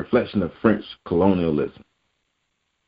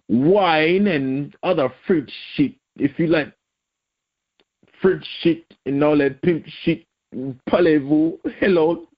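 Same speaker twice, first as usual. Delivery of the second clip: high, silly put-on French voice; hammed-up French accent, pitched way up; mock French accent; 7 semitones higher than usual. Vlogger spoofing a Frenchman who likes French stuff, mock French accent.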